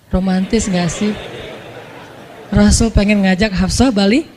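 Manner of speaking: ordinary talk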